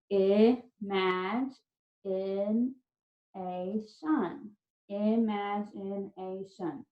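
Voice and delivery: IMAGINATION slowly broken into ih-maj-in-ay-shun; syllable by syllable. Shown as a demonstration. Teacher breaking a spelling word down, IMAGINATION slowly in five syllables. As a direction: sound out IMAGINATION with gaps between syllables. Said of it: "Lots of syllables in this word".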